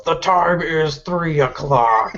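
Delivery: slow, loud announcing yell. Acted. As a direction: bellow it out slowly like a town crier. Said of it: "he yells".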